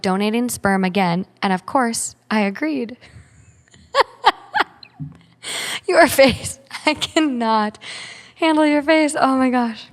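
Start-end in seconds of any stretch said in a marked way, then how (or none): 3.9-9.9 laughing at a face. Real, not acted. Laughing at cohost's reaction.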